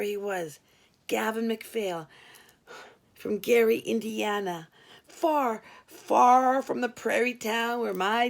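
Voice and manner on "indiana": ordinary delivery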